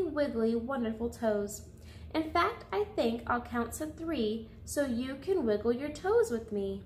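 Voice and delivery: plain reading voice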